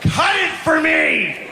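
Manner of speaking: put-on high, stretched-out toddler shriek; slow, piercing tantrum demand; angry three-year-old. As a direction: order a parent around like a furious child. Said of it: "she's a little ball of anger".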